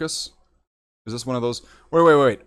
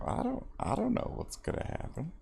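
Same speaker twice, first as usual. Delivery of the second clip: whispered I don't know what's gonna happen, no voice at all; 7 semitones higher, 12 dB quieter, faster than usual. Shown as a demonstration. Streamer musing to his viewers, whispering. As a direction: breathe it out as a whisper. Repeats line, then whispers it.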